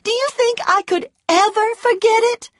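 put-on high, eager young woman begging; Scarlet pleading. A narrator's reading. Scarlet begging Ashley to run away, high and pleading. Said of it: "she said".

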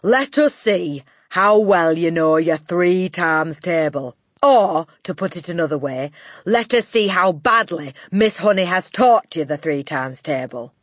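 loud, booming headmistress; Trunchbull. A narrator's reading. Headmistress testing the class's times table, booming scorn. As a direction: boom it out with scornful authority. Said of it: "boomed the Trunchbull".

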